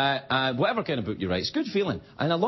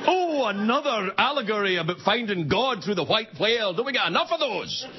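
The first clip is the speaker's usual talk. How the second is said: sneering critic; 6 semitones higher than usual. Retold or imitated. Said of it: "Jeers to Moby Dick".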